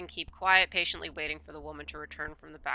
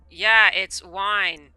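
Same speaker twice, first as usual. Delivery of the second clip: slow deadpan; 12 dB louder, much slower than usual. Real, not acted. Answering the shopkeeper deadpan.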